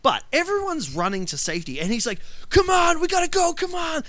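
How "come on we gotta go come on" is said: high, loud, urgent imitation of yelling; hammed-up shout to hurry everyone along; mock yelling. Film fan mimics the hothead rushing everyone to safety, mock yelling. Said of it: "he's constantly yelling at everyone else".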